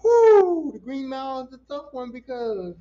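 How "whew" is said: high, loud exhaled "whew"; loud whoo. Real, not acted. Reacting to The Green Mile as a tough one, loud whew. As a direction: let out a big, high whew.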